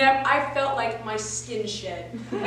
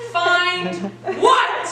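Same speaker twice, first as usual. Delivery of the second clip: loud, high, disbelieving burst; each word fired off loudly after a pause; alarmed shout; 4 semitones higher, 5 dB louder than usual. Acted. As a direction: blurt it out in shock, climbing higher. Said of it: "I didn't say it like this".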